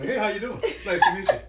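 high, bright-toned customer-service voice, laughing through it; chirpy office politeness with a laugh. Retold or imitated.

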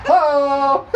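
"Ho!" belted high and loud like a cartoon hero; He-Man shout. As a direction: boom it out like He-Man. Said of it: "I'll say it like that too, just like He-Man".

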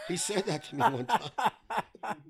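chuckling through the words; laughing. Real, not acted. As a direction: laugh while telling it. Laughing at the memory.

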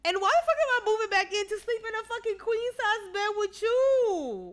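high, loud outraged question; indignant rant. Retold or imitated. Viewer ranting about sharing the bed again, outraged.

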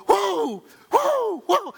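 sharp high whoops, rising each time; playful woo noises. Retold or imitated. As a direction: yelp it like a showboating player. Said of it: "I'd just be making noise".

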